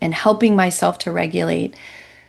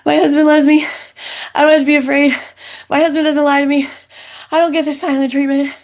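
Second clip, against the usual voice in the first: higher, louder tearful pleading; retold sobbing; 7 semitones higher, 3 dB louder, faster than usual. Wife repeating truths to herself in early days, re-enacted tearful sobbing. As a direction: cry out the truths through tears, re-enacted. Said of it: "I can remember in the early days sobbing".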